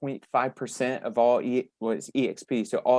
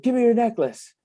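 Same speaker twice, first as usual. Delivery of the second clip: robber demand; 10 semitones higher, 3 dB louder than usual. Retold or imitated. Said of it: "he was like".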